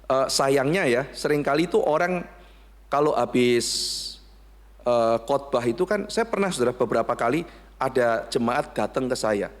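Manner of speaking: ordinary talk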